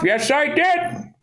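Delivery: put-on old man telling the kid it's his turn. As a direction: play a smug retired grandpa on the porch. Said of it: "I just wanna be an old man".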